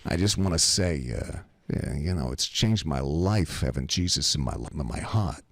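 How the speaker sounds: low wise-guy testimony; imitated East Coast tough guy; Pasquale. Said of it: "just like this. He goes".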